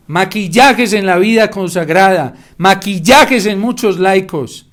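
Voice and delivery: fervent slow rebuke; indignant preaching. Real, not acted. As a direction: denounce the masks, slowly and hard. Preacher denouncing hypocrisy, rising.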